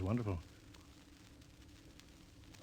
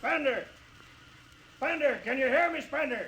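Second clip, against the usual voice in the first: high, carrying call up the hill. Acted. Captain calling up to Spender in the hills, shouting. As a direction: shout his name across the distance.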